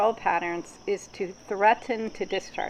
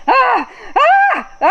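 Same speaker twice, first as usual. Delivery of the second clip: high, loud, breathy ahs of pleasure; orgasm sounds; 11 dB louder, much slower than usual. Shown as a demonstration. Therapist demonstrating the sounds people are told to hush, loud moaning. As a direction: let out unrestrained pleasure cries. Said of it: "Why can't you go".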